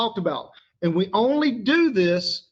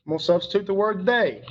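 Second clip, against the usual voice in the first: ordinary delivery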